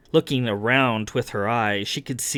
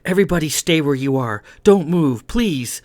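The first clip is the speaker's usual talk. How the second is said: raised, pressing plea; higher, louder, anxious insistence; urgent warning; 5 semitones higher, 4 dB louder than usual. Acted. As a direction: urge everyone to keep still. Character backs an urgent warning.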